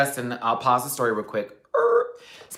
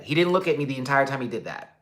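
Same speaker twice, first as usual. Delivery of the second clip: ordinary talk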